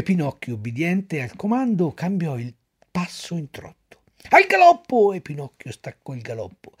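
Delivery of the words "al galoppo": very high, loud ring call; shouted command. Acted. Director commands Pinocchio to gallop, loud. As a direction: drive the donkey on with the shout.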